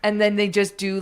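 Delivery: ordinary talk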